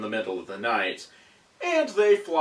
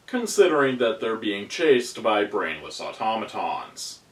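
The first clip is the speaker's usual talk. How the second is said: normal talking voice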